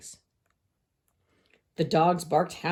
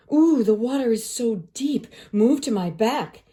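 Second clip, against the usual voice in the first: insistent fox; 5 semitones higher, 4 dB louder than usual. A narrator's reading. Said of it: "he insisted".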